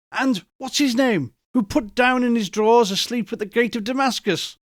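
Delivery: excited, near laughter. A narrator's reading. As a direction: tumble out the memories breathlessly. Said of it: "between laughing and crying".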